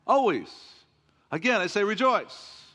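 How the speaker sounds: preacher proclaiming the verse to the church; ringing refrain. Acted. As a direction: lift the verse up like a shout of praise.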